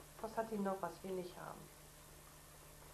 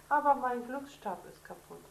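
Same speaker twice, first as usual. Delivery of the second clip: mock sob; 5 semitones higher, 9 dB louder than usual. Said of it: "schluchzt Lilli".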